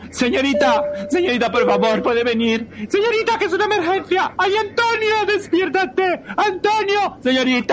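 high, loud, frantic pleading; frantic call for help. Acted. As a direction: beg the attendant urgently. Calling for help, emergency. (llamada desesperada pidiendo ayuda)

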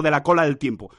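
normal talking voice

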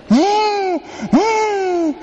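put-on high wailing Ñe, Ñe from a baby; mock baby crying. Preacher imitating the baby crying on the plane, high wail. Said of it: "comienza la lloradera".

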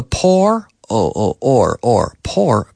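"poor" with an "or" sound, isolated; double O as or. Shown as a demonstration. Teacher shows double O possibilities, "or" in "poor". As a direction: pull the "or" out of "poor", then repeat it. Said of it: "different pronunciation possibilities".

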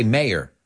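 "mayor" in two syllables, MAY-er; American pronunciation. Shown as a demonstration. Teacher showing the States' way, "mayor" as MAY-er. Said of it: "In the States, we say".

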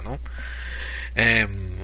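ordinary delivery

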